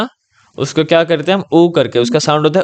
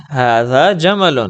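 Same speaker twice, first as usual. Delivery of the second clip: slow, careful Arabic model line; slow model reading; much slower than usual. Shown as a demonstration. Teacher models ھذا جمل slowly for the pupil. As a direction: spell it out slowly for a learner. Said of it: "ہم اب آگے کرتے ہیں".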